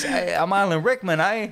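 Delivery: high, hammed-up British accent; Alan Rickman. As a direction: do a silly, badly faked British accent. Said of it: "just to do that accent".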